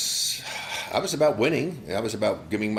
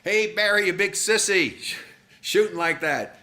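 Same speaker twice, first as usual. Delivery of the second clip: heckler's yell; 8 semitones higher than usual. Retold or imitated. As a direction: imitate a heckling fan yelling out. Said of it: "Guy in the stands yells out".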